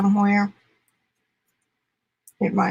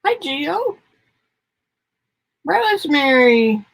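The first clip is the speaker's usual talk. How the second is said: sing-song greeting; 7 semitones higher, slower than usual. Real, not acted. Greeting pets or visitors.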